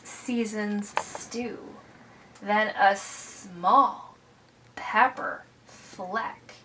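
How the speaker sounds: measured read-aloud fading to a hush; slow it down and shrink to almost nothing; suspenseful story narration. A narrator's reading. Reads a picture book to children.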